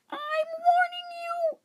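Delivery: a tiny hamster trying to sound fierce; squeaky hamster warning. Acted. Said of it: "I squeaked".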